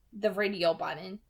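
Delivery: plain reading voice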